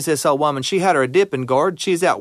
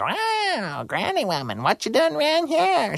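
high, soft, strange bobcat voice; odd bobcat voice; 11 semitones higher, 4 dB quieter than usual. Acted. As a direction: purr it out like a curious cat.

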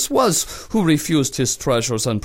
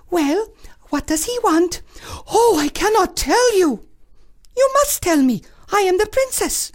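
cartoon princess. Princess demands answer.

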